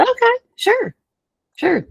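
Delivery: high, chirpy acted yes; eager agreement. Niece agreeing to aunt's bun errand, high and eager.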